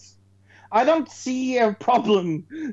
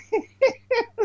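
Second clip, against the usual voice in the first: laughing. Real, not acted. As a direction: burst of high laughter. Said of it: "Sneezing and laughing".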